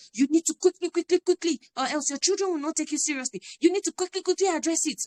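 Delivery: mock panicked parent rushing to punish; mock urgency. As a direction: parody the panicked rush to discipline. Mocking parents' rushed discipline.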